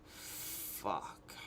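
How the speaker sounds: breathless whispered curse, low and dismayed. Retold or imitated. Hearing her about to vomit, a whispered dismayed curse. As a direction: breathe the word out in dread.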